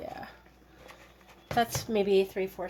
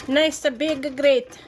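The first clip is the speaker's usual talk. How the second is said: slow, louder, higher showy "nice"; hammed-up excitement; 5 semitones higher, 6 dB louder, slower than usual. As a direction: play up the pun. Playful pun on grate.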